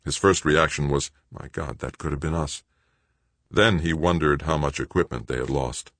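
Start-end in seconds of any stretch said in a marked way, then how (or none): 1.3-2.6 hushed shock. A narrator's reading. Doug's shocked thought.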